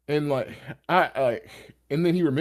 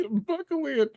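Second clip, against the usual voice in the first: laughing aside; 14 semitones higher than usual. Retold or imitated. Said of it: "I said".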